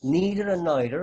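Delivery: NEITHER as NEE-ther, then NY-ther; two neither pronunciations. Shown as a demonstration. Teacher shows both are the same, both neither pronunciations. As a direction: say "neither" first with EE, then with EYE. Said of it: "It's the same".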